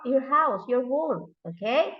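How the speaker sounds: ordinary talk, nothing marked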